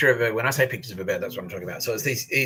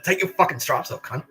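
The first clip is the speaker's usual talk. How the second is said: higher, re-enacted aggressive dare; retold angry challenge; 6 semitones higher, 4 dB louder than usual. Prisoner daring screw to drop his rank, retold anger. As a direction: escalate into a retold fighting challenge.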